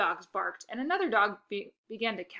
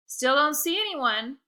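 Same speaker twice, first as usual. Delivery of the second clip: slow, high, dry tease; dry teasing; 6 semitones higher, 7 dB louder than usual. Acted. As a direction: drag it out to needle him. Lexi teasing, drawn out.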